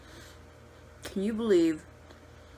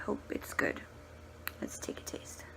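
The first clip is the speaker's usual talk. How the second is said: whispering; 8 dB quieter than usual. Real, not acted. Quiet aside before tasting food.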